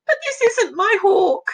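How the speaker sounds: high, loud insistence on this; dismayed denial. Acted. Falconer rejecting the bird, high loud denial. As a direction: refuse it with a high, pressed voice. Reading a dismayed quote aloud.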